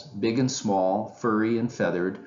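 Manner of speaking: usual speaking voice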